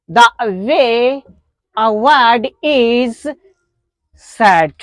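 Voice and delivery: a word is said, stretched out very slowly; slow definition. Shown as a demonstration. Teacher defining pronunciation, very slowly. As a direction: read the definition very slowly for students. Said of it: "what is pronunciation".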